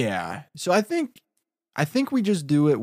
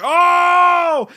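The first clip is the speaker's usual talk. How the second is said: high, loud crowd "oh"; the bar erupts at the near miss; crowd gasp; 8 dB louder than usual. Acted. The whole bar reacts to the dart throw with a loud high gasp.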